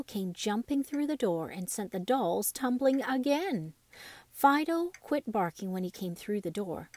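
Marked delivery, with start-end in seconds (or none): none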